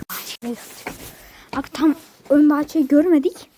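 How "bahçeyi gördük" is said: whispering while hiding. Real, not acted. Sneaking in the dark.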